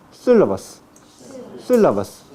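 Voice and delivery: "sílabas" with tongue curled up to the roof of the mouth; Castilian apico-alveolar s in "sílabas"; apical s in sílabas. Shown as a demonstration. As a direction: demonstrate a retracted s in "sílabas", falling firmly. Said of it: "doblar la lengua como si fuera".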